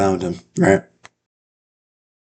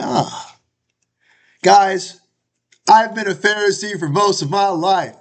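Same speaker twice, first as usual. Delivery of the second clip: eager Paul; 10 semitones higher than usual. Acted. Paul pulling out all the stops with the split crowd, hammed-up. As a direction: play it like a showman working the room.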